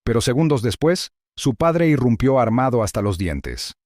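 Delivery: punchy teaser hook, slowing on the call to subscribe; clickbait narrator. A narrator's reading. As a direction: sell the hook like a viral video intro. Video hook before the story. (narrador de vídeo viral)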